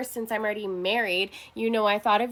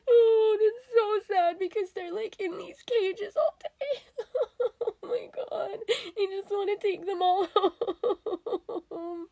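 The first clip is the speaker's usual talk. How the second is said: higher, teary voice, crying and laughing at once; laughing through tears; 8 semitones higher, 4 dB quieter, slower than usual. Real, not acted. To the camera about adoption videos, teary laugh-crying. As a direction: choke up and giggle through it. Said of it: "I'm not crazy, I swear".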